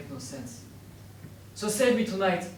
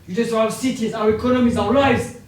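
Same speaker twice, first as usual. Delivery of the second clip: loud, rising accusations; building furious yell; angry shout; 5 semitones higher, 10 dB louder than usual. Acted. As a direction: hurl each charge louder.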